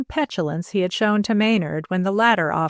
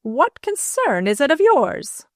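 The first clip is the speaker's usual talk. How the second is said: sharply raised pitch, indignant challenge; furious; 6 semitones higher than usual. A narrator's reading. Mrs. Ward answering the prying butler, furious. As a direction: throw it back as an angry retort.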